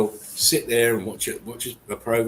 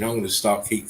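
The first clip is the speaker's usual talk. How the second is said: ordinary talk, nothing marked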